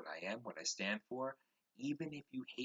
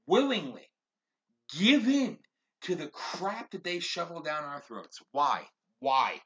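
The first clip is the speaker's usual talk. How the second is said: louder and higher as the anger builds; rising rant; 7 semitones higher, 8 dB louder than usual. Real, not acted. Ranting at listeners about people who give in, building anger. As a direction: push harder on each phrase, fed up.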